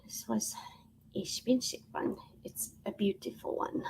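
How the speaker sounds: 高価な品をそっと囁く; whisper. Real, not acted. Confiding over a pricey item.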